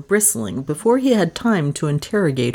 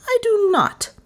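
high-pitched. A narrator's reading. Repeated denial, much higher.

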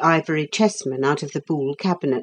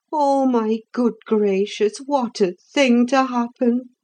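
mild sigh, higher cook voice; 7 semitones higher than usual. A narrator's reading. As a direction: sigh it out, a touch of grief. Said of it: "sighed the cook".

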